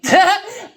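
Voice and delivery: sudden high cackle of delight; loud amused high-pitched laugh. Real, not acted. Laughs at his reply.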